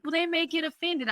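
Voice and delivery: high, doubtful client voice; worried client. Retold or imitated. Client worried others will be offended, high and doubtful. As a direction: voice the hesitant client. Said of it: "she's like".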